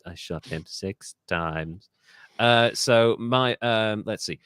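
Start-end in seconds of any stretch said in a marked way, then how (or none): 0.1-1.9 low, soft, slow delivery; hushed film quote. Acted. Host repeating a film line, low hushed impression. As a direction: drop down low and draw it out quietly.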